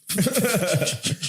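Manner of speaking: high bright burst of laughter. Real, not acted. Host cracking up at the foot joke.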